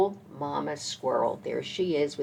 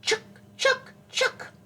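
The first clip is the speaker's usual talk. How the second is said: high, sharp squirrel chucks; squirrel chatter. A narrator's reading. Teacher showing children what mama squirrel says, high squirrel chatter. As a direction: squeak it out in quick separate bursts. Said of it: "clattered in the high branches".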